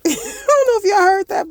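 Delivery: laughing it off, voice jumping high. Real, not acted. Laughing to viewers after shooing away a chatty coworker. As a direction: giggle through the words, a little embarrassed.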